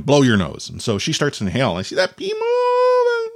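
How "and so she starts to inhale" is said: normal talking voice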